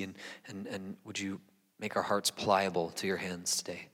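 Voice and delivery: soft, hushed prayer voice. Acted. Pastor praying over the text with the church, soft and hushed. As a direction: pray quietly before the congregation.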